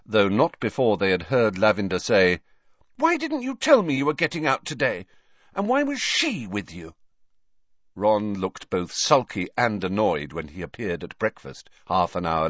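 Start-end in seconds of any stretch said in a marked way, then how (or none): 3.0-6.9 high-pitched, accusing complaint; indignant. A narrator's reading. Lavender confronts boyfriend who left her out, indignant. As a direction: demand an explanation, put out. Thunderous girlfriend confronts Ron.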